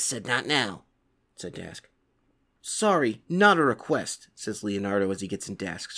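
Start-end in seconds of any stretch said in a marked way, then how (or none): none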